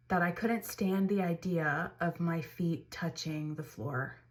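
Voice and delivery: slow, weighed-down delivery; heavy slow retelling. Retold or imitated. New mother recalling the morning she could not get up, slow and heavy. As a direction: drag each word out like it costs effort.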